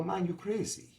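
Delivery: alarmed Jamaican guy pleading with him; high, worried Jamaican accent, sounding the alarm. Retold or imitated. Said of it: "He go".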